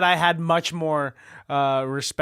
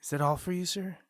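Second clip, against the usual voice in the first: nervous take trailing off much softer; getting quiet; 10 dB quieter than usual. Acted. Nervous cashier actor losing his nerve on camera, fading. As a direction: fade away as the line goes. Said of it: "Why are you getting quiet".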